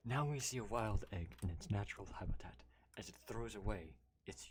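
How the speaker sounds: hushed wildlife narrator voice; nature documentary whisper. Acted.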